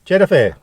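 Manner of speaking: loud toast of Turkish şerefe; Turkish cheers. Pretend. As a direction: shout Cheddar Fair as a Turkish toast. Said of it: "we'll say Turkish cheers".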